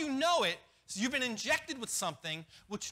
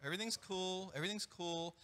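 lower, softer lazy serpent voice; a hint of a soothed snake; mock calm serpent; 4 semitones lower, 7 dB quieter than usual. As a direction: lull them as the charmed snake. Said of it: "the serpent goes".

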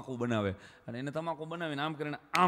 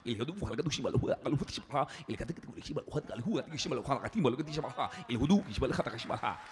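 mock Arabic; 5 semitones lower than usual.

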